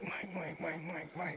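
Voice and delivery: very quiet, repeated under the breath; hushed attention-getting. Acted. Trying to get Mike's attention in a meeting, hushed. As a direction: discreetly nudge a colleague with a tiny voice.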